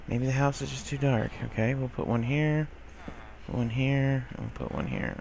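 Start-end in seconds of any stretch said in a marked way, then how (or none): 4.3-5.2 soft unvoiced whisper; whispering to be careful. Real, not acted. Sneaking past a spider, whispering to himself. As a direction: hush it so nothing hears. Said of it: "so I'm being quiet".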